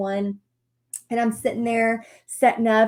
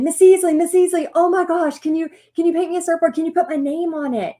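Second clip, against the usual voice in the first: high, loud imitation of eager pupils; mimicked kids clamoring in a rush; excited kid begging; 7 semitones higher, 5 dB louder than usual. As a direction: gush and plead like a kid after the teacher. More kids pestering the teacher.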